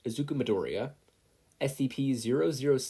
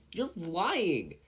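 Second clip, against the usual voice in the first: yelled; 7 semitones higher than usual. A narrator's reading. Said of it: "Kacchan yelled".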